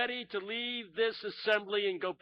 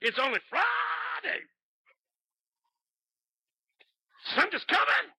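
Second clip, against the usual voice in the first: preaching at full force; 9 semitones higher than usual. Retold or imitated. Pastor building the sermon to a shouted refrain. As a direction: ring it out to the rafters. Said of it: "He took off".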